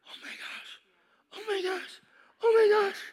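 from a whisper to a high, tearful cry; weeping Mary. Acted. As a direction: whisper breaking into high sobbing cries.

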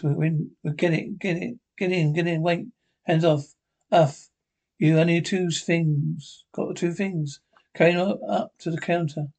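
usual speaking voice

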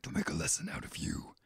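whispered menacing spooky voice. Retold or imitated. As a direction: breathe it like a masked villain. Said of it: "Spooky voice".

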